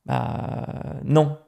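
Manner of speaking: ordinary talk, nothing marked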